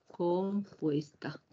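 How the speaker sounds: slow whispered "Compuesta". Shown as a demonstration. Teacher whispers the answer to the class. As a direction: breathe the word out slowly, no voice.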